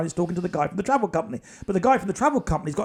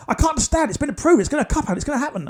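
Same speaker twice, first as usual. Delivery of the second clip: higher insistent agent; 7 semitones higher, 3 dB louder, much faster than usual. Retold or imitated. Agent baffled but insistent.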